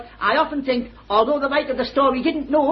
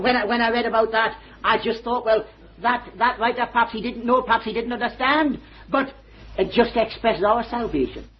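ordinary talk, nothing marked

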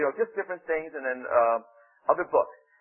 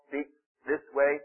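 ordinary delivery

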